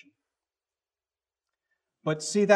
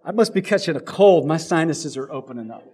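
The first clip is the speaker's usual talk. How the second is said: mock excuse; 3 semitones higher, 8 dB louder than usual. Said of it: "I just go like this".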